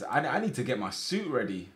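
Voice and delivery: ordinary talk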